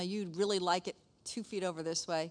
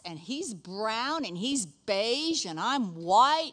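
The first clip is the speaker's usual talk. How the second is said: slow explaining; much slower than usual. Retold or imitated. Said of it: "slow explaining reply to child".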